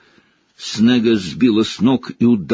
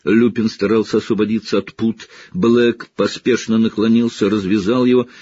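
ordinary delivery

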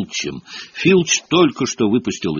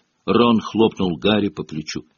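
usual speaking voice

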